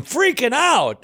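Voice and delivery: high, hammed-up freak-out; mock panic. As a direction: squeal it like a mock meltdown. Acting out panicked Paul.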